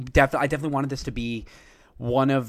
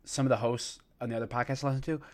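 normal talking voice

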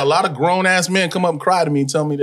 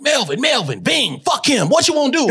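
high, mock-aggressive outburst; fired up; 9 semitones higher than usual. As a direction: fire off challenges, pitched up. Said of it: "I was fired up".

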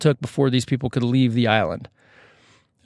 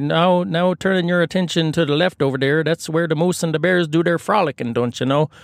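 hammed-up Canadian tour-guide accent, pitched higher; 3 semitones higher than usual. Locals showing refugees the sights of their island, comic Canadian accent. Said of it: "done with the Canadian accents".

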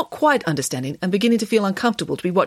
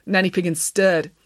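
usual speaking voice

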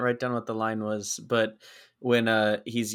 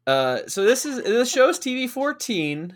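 hammed-up complaining, higher and louder; mock lament; 11 semitones higher, 5 dB louder than usual. Starting a mock lament about violence, hammed-up and higher. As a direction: play up a fake grievance. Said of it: "let me lament".

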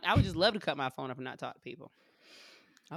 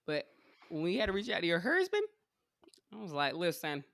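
normal talking voice

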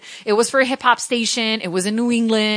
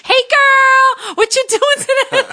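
much higher, louder put-on guy's voice with a laugh; a playful mock of the boyfriend's accent; imitating his accent; 8 dB louder, slower than usual. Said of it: "he just that accent".

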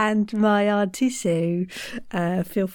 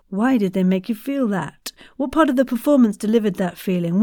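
usual speaking voice

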